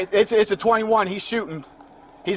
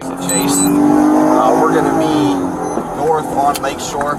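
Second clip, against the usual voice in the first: police radio; much slower than usual. Real, not acted.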